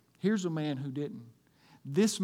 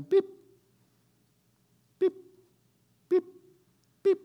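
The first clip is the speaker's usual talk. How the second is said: short, high, evenly spaced beeps; heart monitor beeps; 8 dB louder than usual. Acted. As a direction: imitate a hospital monitor coming back on.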